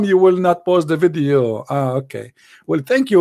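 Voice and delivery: normal talking voice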